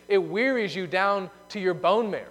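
ordinary talk

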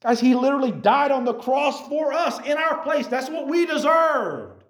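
high, loud preaching at full force; real shouted climax to the youth group; shouting sermon. Real, not acted. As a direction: preach it with fire. Preacher's climax about the cross.

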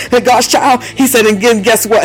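driving charismatic sermon with gasped breaks; fervent preacher breaking into tongues; full-force preaching. Real, not acted. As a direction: build to an impassioned, punched refrain.

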